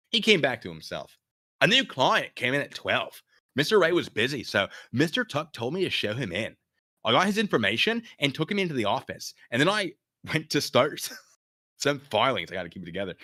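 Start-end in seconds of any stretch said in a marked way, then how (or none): 1.6-12.5 California dude. Acted.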